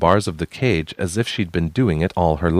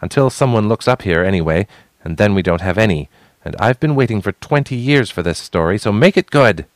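angry demand; 3 semitones higher, 4 dB louder than usual. Acted. Responsibility demanding the story, angry.

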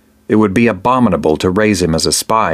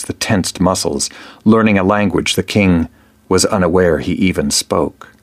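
ordinary delivery, nothing marked

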